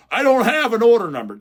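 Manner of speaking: high, loud mock protest; mock complaining customer. Store owner mimicking a customer missing an order number, loud mock whine. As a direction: imitate a customer wailing in complaint.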